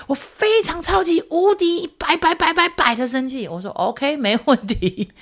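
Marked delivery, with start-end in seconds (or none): 0.1-3.5 put-on high, slow childish voice building on 百; son's exaggerated anger. Son topping his own anger word for mom, slow high child voice. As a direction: drag it out high like a kid outdoing himself. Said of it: "更严重一点的那种生气".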